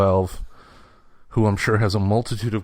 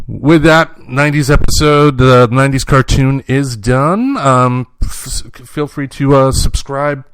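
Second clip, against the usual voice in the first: louder host outro voice; podcast sign-off; 4 semitones higher, 8 dB louder, slower than usual. Acted. Host closing the episode for listeners, announcer style. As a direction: wrap the show up like an announcer.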